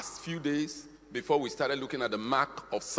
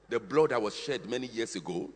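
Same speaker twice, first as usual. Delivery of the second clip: ordinary talk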